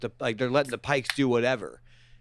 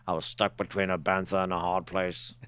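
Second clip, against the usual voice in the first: put-on lower, slightly softer old-hand voice; grizzled cautionary veteran; 6 semitones lower, 5 dB quieter than usual. Noseless character explaining his lost nose, grizzled veteran voice. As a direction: recount the misfortune in a low character voice. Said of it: "he's speaking from experience".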